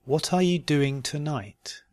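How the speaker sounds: "What are you doing tonight" slowly and carefully. Shown as a demonstration. Teacher giving learners a question to try, slowly. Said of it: "first slowly and then".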